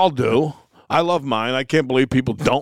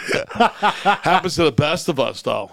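laughing through the words; slow, amused chuckle while talking; 4 semitones higher, slower than usual. Real, not acted. Laughing at the joke.

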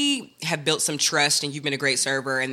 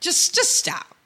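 exasperated plea; slower than usual. Acted. Mocking the server.

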